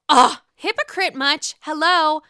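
loud disgusted sass; sassy outrage. Real, not acted. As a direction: call out the hypocrite. Outraged reaction to hypocrisy.

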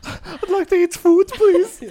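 timid diner, imitated. Acting meek diners ordering from rude waiter.

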